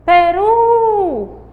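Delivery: Peru with the final "ru" stretched high and loud; stress on last syllable. Shown as a demonstration. Teacher shows pupils the strongest syllable, stressed last syllable of Peru. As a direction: call out Peru, holding the last syllable long. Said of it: "É a sílaba mais forte".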